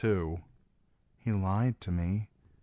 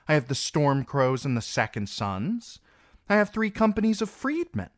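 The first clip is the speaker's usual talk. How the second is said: put-on higher, firm young queen standing her ground; young queen rallying her forces, louder; defiant young queen; 7 semitones higher, 4 dB louder than usual. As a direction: stand tall, refuse to be cowed. Daenerys defiant to council.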